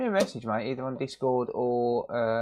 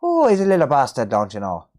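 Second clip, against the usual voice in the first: mock Irish; 6 dB louder, faster than usual. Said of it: "it's an Irish word".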